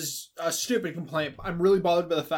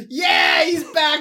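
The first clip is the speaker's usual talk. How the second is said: excited shout; 9 semitones higher, 7 dB louder, slower than usual. Real, not acted. Hyped at Vamp's return.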